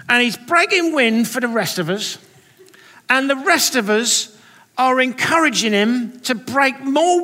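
high, loud, slow mock Cockney; cartoon Cockney accent. Sunday school teacher pressing on through giggles, cartoon Cockney accent. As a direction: build it up as an oblivious cartoon Cockney prophet. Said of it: "talking with what sounds like almost a kind of cartoon accent".

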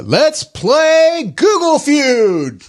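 high, drawn-out game show host announcement. Pretend. Host kicking off the Google Feud game, game show style. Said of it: "I'm gonna try to be as good as that".

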